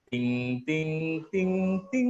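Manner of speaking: sung "ting" notes stepping up in pitch; imitated airport chime. Coach imitating the mall and airport jingle, chiming. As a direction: mimic a public announcement chime. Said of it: "suara yang kayak gini".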